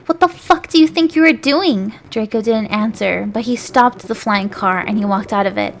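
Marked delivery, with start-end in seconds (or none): 0.0-1.9 angry demand, higher and louder. Acted. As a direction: demand an answer. Angry confrontation in story.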